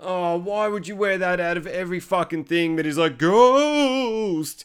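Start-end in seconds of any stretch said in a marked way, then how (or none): 3.2-4.6 high, drawn-out ghostly wail; hammed-up ghost cry; spooky mock call. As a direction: stretch the word out to spook the kids. Acting sheeted man scaring school kids.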